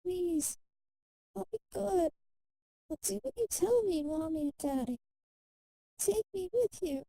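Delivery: put-on high, childlike pleading voice; pleading little girl. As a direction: beg like a small child left behind. Dying girl begs her parents.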